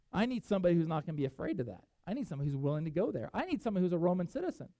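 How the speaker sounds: fast, driving list of needs; God listing what he needs, quick and firm; rapid insistence. Retold or imitated. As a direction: rattle them off quickly.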